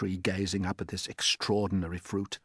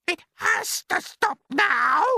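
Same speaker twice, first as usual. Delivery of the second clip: a far higher, louder aunt's shout; Aunt Spiker shouting; 8 dB louder, slower than usual. Acted. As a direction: screech it out as the nasty aunt. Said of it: "Aunt Spiker yelled".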